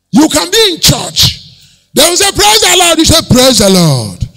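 loud, high-pitched pulpit proclamation; forceful preaching. Real, not acted. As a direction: drive it home to the whole congregation at full voice.

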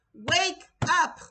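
loud, hard "Wake up" calling them to attention; urgent shout. Real, not acted. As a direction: shout it like an alarm. Preacher urging viewers to wake.

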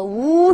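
呜 held as a long "oo"; ou sound. Shown as a demonstration. Teacher showing how OU sounds, long 呜. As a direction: sustain 呜 as one pure vowel. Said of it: "欧语的发音叫做呜".

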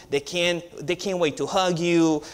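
ordinary delivery

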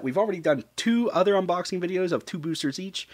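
ordinary delivery

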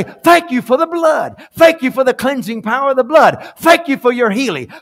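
high, loud preaching cry; shouted praise. Shown as a demonstration. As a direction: shout thanks with fervour. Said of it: "When I say".

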